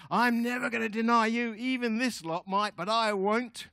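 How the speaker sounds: loud, high-pitched boastful pledge; vehement vow. Acted. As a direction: swear it at full volume, hammed-up. Peter's boastful vow acted out.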